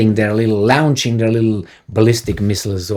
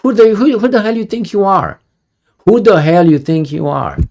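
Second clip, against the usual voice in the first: imagined confrontation; 6 semitones higher than usual. Retold or imitated. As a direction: demand it of the spokesman, outraged. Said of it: "nobody like me to ask him".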